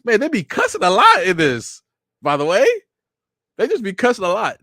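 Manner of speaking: high, loud excited aside; high excited commentary. Real, not acted. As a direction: blurt it out, amused. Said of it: "reacting loudly to show".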